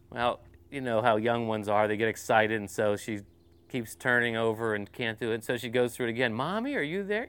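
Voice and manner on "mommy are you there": put-on higher, small child calling in the dark; a child checking that mommy is near; little girl's voice. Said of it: "this little voice".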